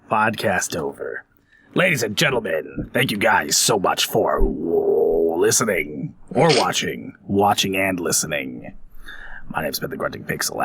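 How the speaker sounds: mock pilot sign-off.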